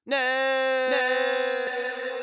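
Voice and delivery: high, loud cry of despair; mock scream as he vanishes; anguished scream. Sketch character being canceled.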